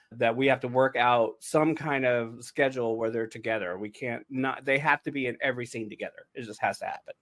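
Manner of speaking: normal talking voice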